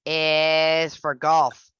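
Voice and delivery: golf stretched out slowly and leaned on; slow, stressed golf. Shown as a demonstration. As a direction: draw out golf for the class. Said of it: "So the other T".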